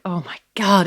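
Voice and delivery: retold oh-my-God moment of relief; relieved exclamation. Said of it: "a relief".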